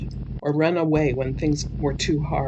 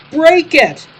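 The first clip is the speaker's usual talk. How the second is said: loud, high shouted order; hollered; 13 semitones higher, 12 dB louder than usual. Acted. Donnie shouting to the panicking driver, loud. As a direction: bark it at the driver. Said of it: "hollered Donnie".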